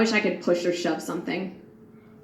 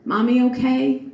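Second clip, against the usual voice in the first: lightly voiced small child, higher and louder; voicing her little boys; 4 semitones higher, 5 dB louder than usual. Grieving mother voices her sons comforting her, light childlike. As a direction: ask it like a worried toddler. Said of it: "they ask me".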